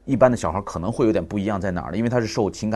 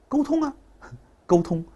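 put-on much higher, laughing child answer; toddler voice. Son telling father what works, high child voice. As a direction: say it like a pleased little boy.

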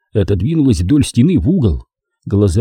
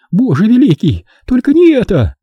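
high, louder anguished plea; despairing cry; 12 semitones higher, 4 dB louder than usual. Acted. Naomi in despair.

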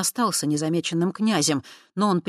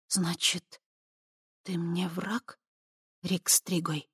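breathless hushed whisper; 2 semitones higher, 6 dB quieter, slower than usual. Acted. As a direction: whisper it, holding your breath. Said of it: "затаив дыхание, прошептала она".

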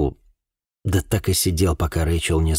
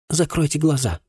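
higher-pitched, pressing repeated request; insistent repeat; 10 semitones higher than usual. A narrator's reading. Girl asks the man to shut his eyes, insistent. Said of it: "повторила Рэйчел".

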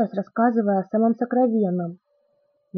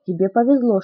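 ordinary delivery

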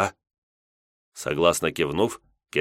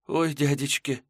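put-on soft girl voice; 8 semitones higher, 3 dB quieter than usual. Maiden sighs.

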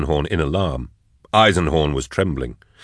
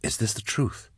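hushed, higher whispered question; 5 semitones higher, 10 dB quieter than usual. A narrator's reading. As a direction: ask it softly, under your breath. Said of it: "Drusha whispered to Eisenhorn".

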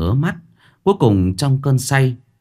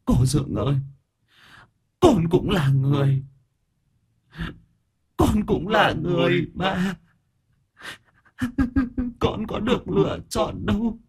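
ghost weeping; 12 semitones higher than usual. A narrator's reading. Drowned boy's ghost crying to his aunt and uncle. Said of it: "Minh lại bật khóc".

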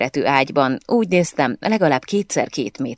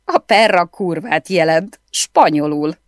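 bursting out laughing while explaining; 4 semitones higher, 5 dB louder than usual. A narrator's reading. Marita explaining the swear word, laughing. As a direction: laugh it out, can't hold it in. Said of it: "próbálja visszatartani a nevetést".

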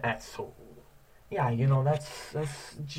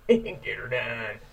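tow truck voice; 6 dB louder, slower than usual. Retold or imitated. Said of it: "I'm the tow truck".

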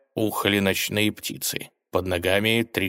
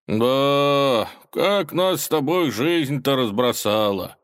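slow, higher, drawn-out reminiscing; nostalgic drawl; 6 semitones higher, 4 dB louder, slower than usual. A narrator's reading. Robber reminiscing with his old schoolmate, nostalgic. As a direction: sigh it out wistfully, stretching the words. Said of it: "ностальгически протянул Соловей".

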